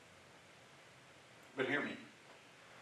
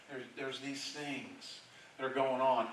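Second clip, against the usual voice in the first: ordinary talk, nothing marked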